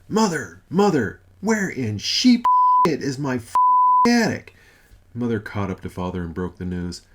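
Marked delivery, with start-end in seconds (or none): 0.1-4.5 loud high shouts, slowing into a furious roar; calling out. Acted. God searching rooms for his attic, shouting for Mother. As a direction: call through the house, then explode. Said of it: "His voice rang out".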